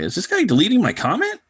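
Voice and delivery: high, suspicious grumble to himself; annoyed inner voice. Retold or imitated. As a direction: wonder aloud, getting irritated. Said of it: "I was like".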